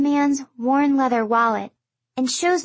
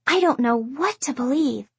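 ordinary delivery

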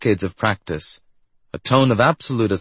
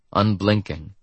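plain reading voice

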